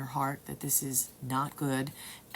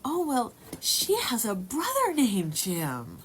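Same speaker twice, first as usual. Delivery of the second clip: quoting Sarah; 8 semitones higher, 5 dB louder, slower than usual. Retold or imitated. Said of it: "my friend Sarah said".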